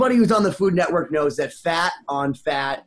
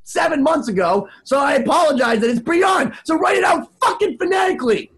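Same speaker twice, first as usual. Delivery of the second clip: high, shouted mock-exasperated outburst; exasperated rant; 7 semitones higher than usual. Wedding DJ apologizing to a client over a name, exasperated.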